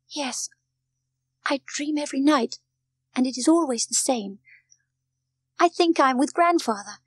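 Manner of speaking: put-on high-pitched little girl confiding a dream; young Heidi, wistful. A narrator's reading. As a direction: tell it as an earnest, homesick child. Heidi tells the doctor her dream.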